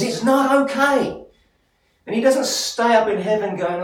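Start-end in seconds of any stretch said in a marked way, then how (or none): 0.0-1.2 a much higher, louder, slower "It's not okay" as God's voice; God's firm declaration. Acted. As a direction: voice God rising high and firm, pressing each word. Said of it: "God looks at this and says".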